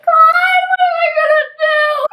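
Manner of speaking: very high, loud mock wail; crying prayer. Lost girl crying and praying to God, hammed-up wailing. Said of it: "I was just crying and praying".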